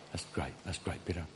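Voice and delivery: very soft, low reassurance; gentle approval. Retold or imitated. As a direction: reassure him tenderly, almost under the breath. Jesus gently accepts Peter's answer, retold.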